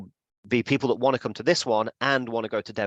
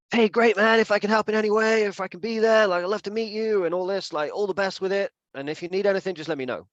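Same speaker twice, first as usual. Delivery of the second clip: super friendly; 9 semitones higher than usual. Retold or imitated. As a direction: cheer him on warmly. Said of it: "super friendly, super supportive".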